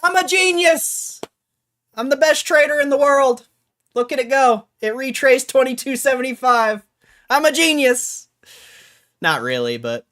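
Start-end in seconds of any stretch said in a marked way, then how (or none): 0.0-1.2 slow, high, loud gloat; mock boasting. Mock gloating after win. 1.9-8.3 high loud bragging; boastful. Pretend. Gloating after win.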